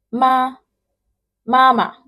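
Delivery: MA high and level; first tone. Shown as a demonstration. Teacher giving the word for mom, high level "Ma". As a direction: start "Ma" high and hold it straight. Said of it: "This first one means mom".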